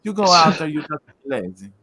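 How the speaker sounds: lightly hammed-up Italian, like Mario; mock Italian accent. Rush told to be a lazy Mario, in mock Italian accent. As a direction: play it as a cartoon Italian plumber. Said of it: "making them sound like they're Italian".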